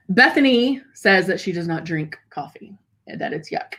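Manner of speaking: normal talking voice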